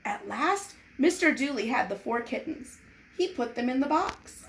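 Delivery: ordinary delivery, nothing marked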